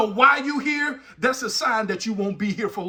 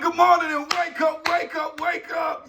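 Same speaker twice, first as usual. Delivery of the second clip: wake-up shout; 6 semitones higher than usual. Real, not acted. Host shouting good morning to his listeners. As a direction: rouse the crowd awake. Opening call to wake audience.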